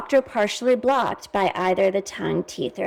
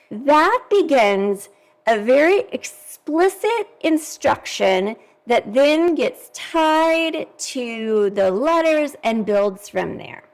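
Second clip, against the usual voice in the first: ordinary talk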